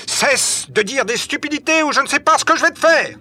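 very high, heated yelling; furious threat. A narrator's reading. Michel threatens Nicole before they fight, furious. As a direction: threaten him at the top of the voice.

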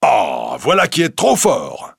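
indignant exclamation. A narrator's reading. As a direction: exclaim in astonished protest. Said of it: "poussa une exclamation".

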